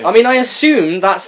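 usual speaking voice

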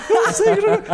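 high, loud mock sob through laughter; half-laughing imitation of his own crying. Retelling how he cried in front of his friend, high laughing mock sob. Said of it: "chorando, né".

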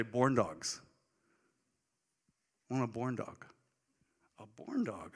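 put-on child saying born dog; childish mispronunciation. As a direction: imitate a little girl saying born dog. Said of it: "she'd say, "Born dogs".

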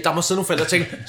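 usual speaking voice

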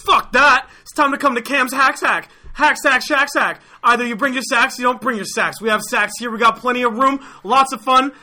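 hard-sell it in a high, driving voice; high-energy ad pitch. Acted. Rival company selling hacky sack fun, high-energy pitch.

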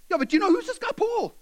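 fast, high-pitched challenge; acted heated grumbling; indignant questioning. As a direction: press the question in exasperation. Slaves grumble about Paul.

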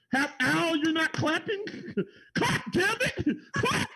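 laughing, high-pitched insistence to clap; playful demand. Real, not acted. Teasing a friend who won't applaud, laughing and high. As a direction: jokingly badger Al into clapping.

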